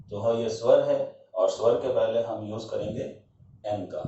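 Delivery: normal talking voice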